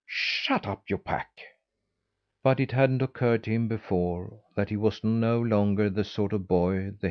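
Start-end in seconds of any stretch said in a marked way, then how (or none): 0.1-1.3 mock shout, more breath than volume; understated shout. A narrator's reading. Boy shouting at the taunting hens, restrained narrated shout. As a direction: snap it at the hens without real volume. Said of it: "threw a stone at them and shouted".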